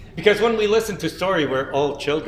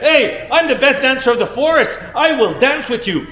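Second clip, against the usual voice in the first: higher, louder proud boast; boastful animal; 7 semitones higher, 6 dB louder than usual. Acted. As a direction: show off as the best dancer.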